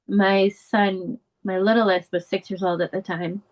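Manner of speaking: ordinary delivery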